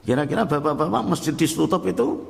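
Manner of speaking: ordinary talk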